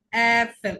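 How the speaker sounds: "অ্যাপেল" with a long, stretched "অ্যা"; lengthened first vowel. Shown as a demonstration. Teacher giving a German umlaut example, first vowel lengthened. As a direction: draw out the start of "অ্যাপেল". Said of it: "একটু দীর্ঘ করে বলতে হবে".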